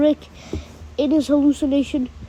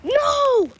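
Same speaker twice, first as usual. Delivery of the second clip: very high drawn-out cry; anguished yell; 11 semitones higher than usual. A narrator's reading. Merle as the governor is killed, drawn-out yell. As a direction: yell it long and high in protest. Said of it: "Merle yells".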